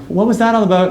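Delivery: high puzzled question; puzzled wife. Retold or imitated. Wife asks husband about the stranger, high puzzled.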